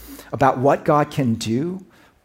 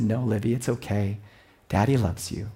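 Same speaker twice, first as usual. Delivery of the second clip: low, soft reassuring dad; soft loving father; 5 semitones lower, 6 dB quieter than usual. Retold or imitated. Dad telling his daughter he loves her, softly. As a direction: gently reassure your little girl.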